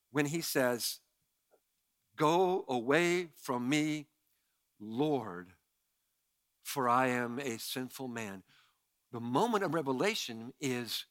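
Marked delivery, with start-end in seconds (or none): 2.2-8.4 slow, lowered, softer delivery; hushed confession. Acted. Peter confessing to Jesus that he is sinful, hushed and slow. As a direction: sink slowly into a quiet, low admission.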